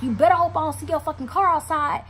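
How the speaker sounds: high, loud warning; angry threat. Retold or imitated. Betrayed woman threatens the man's car, high and angry. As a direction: hurl a retold threat at him.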